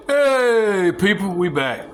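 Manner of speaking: high, bright call of "Hey" that drops back down; cheerful hey to the camera; excited greeting. Real, not acted. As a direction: call out to the viewers, glad to be back. Greets viewers returning on camera.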